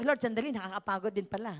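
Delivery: fast laughing mock weariness; laughing quote to God. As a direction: toss it off with a laugh. Sabi ko.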